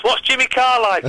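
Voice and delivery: high-pitched mock fan question; imitated audience member. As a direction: put on a high voice as an eager questioner. Mimicking audience's repeated question.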